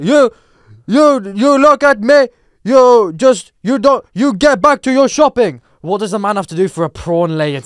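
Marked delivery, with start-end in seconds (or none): 0.0-5.6 loud, high, slow orders; imitated outraged yelling; mock angry shout. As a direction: bellow it like an indignant stranger. Acted angry man ordering him off.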